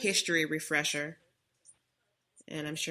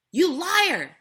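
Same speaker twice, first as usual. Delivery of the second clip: much higher, much louder screamed accusation; furious shout; 12 semitones higher, 13 dB louder than usual. Retold or imitated. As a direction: scream it at her like the stranger did. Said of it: "he's yelling the whole time".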